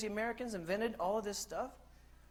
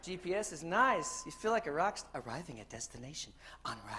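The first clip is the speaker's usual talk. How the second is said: ordinary talk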